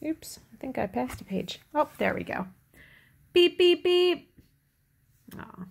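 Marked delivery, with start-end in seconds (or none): 3.3-4.3 three high, loud toots; truck beeps. A narrator's reading. Reader closes the truck story, high loud beeps. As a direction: beep cheerily like a toy horn.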